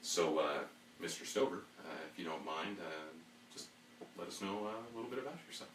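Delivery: low, soft formal interviewer. Pretend. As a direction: ask it like a stiff hiring manager. Plays a job interviewer.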